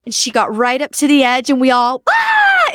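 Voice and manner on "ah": very high, loud excited crowd roar. Retold or imitated. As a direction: burst out like the crowd exploding.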